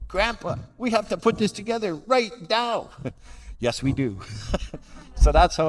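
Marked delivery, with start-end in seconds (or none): 0.1-2.9 put-on high, eager little kid voice; grandson's voice. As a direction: play the excited grandchild begging. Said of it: "my grandson was all over me".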